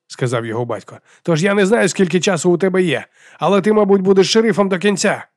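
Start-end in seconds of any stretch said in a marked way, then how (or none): 1.2-5.3 raised, high voice over the din; urgent radio briefing. A narrator's reading. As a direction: press the deputy urgently over a noisy radio. Батько терміново говорить із заступником крізь шум. (напружено й голосно говорить у рацію)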